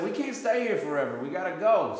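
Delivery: loud, high insistence; retold urging. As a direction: hurry them along loudly.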